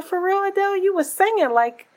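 mock incredulous. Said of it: "I said".

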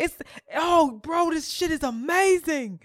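high, amazed bro voice; imitated going in. Boy telling his friend about Curl Fest, imitated raving. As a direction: rave it loudly, mimicking his hype. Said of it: "yo, yeah, he was going in".

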